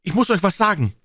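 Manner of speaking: put-on high urgent boyish call; high-pitched pleading yell; bellowing after them. A narrator's reading. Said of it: "denselben nachbrüllend".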